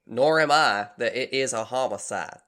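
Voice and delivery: a southern lab director giving a statement; southern accent. Acted. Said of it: "how I did with that accent".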